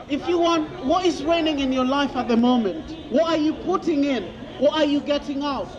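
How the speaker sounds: ordinary talk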